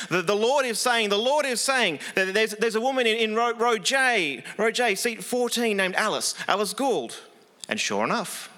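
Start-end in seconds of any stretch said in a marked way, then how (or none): 0.1-7.2 higher, stagey faith-healer call-out; imitating a faith healer. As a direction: announce the name like a stage revelation. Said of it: "he'd say".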